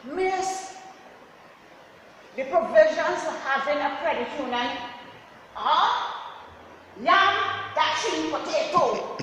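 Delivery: high, loud confident answer; proud pupil answer. Retold or imitated. Boy proudly explaining provisions to the class, high and loud.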